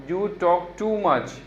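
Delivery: "too" said clearly as a long too; careful pronunciation. Shown as a demonstration. Teacher models how to say "too" correctly. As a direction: stretch the vowel in "too". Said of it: "इसको बहुत लोग टू बोलते हैं".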